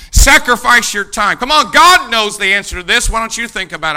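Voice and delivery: loud urging appeal; preaching at full force. Acted. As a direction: exhort the crowd loudly. Preaching exhortation.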